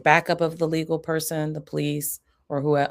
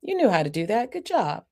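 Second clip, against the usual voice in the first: much higher, bright praise; high excited praise for picking up papers; big praise; 10 semitones higher than usual. Shown as a demonstration. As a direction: cheer the child on. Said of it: "the first two times".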